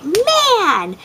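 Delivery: put-on high, sharp little-girl "Man!"; a toddler parroting mom's frustration; daughter copying mom. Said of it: "she was like".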